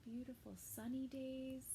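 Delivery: ordinary delivery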